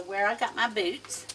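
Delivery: ordinary delivery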